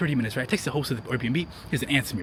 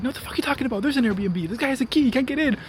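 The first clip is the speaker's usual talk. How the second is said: much higher, exasperated mock yell at the old lady; retold yelling neighbor; 10 semitones higher than usual. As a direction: imitate the neighbor's pitched-up angry outburst. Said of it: "The neighbor starts yelling at her".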